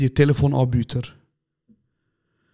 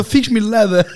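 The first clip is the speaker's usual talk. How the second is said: louder, higher laughing request; laughing plea; 7 semitones higher, 5 dB louder than usual. Retold or imitated. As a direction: beg it through laughter. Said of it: "ich so". (lachend und lauter bitten)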